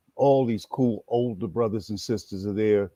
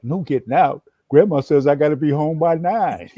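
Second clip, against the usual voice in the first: put-on higher and louder, a scared kid's alarm; panicked inner voice; 6 semitones higher, 7 dB louder than usual. Fifteen-year-old's inner voice at the Panther office, alarmed and high. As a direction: blurt it out like a teen who just realized he's trapped. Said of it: "my inner voice is like".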